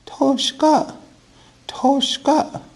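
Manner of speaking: very high falsetto woman's voice. Shown as a demonstration. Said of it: "trying to say it like a woman".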